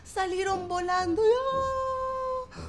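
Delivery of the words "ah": high startled ah; flying scream. A narrator's reading. Neighbours blown up high by the sneeze, startled cry. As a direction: cry out as you're blown away.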